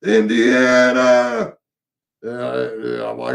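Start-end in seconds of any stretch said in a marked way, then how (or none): 0.0-1.6 slow, loud mock scream of the name; the stranger screaming his one word. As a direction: bellow Indiana in an odd voice, drawn out. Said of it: "he started screaming again".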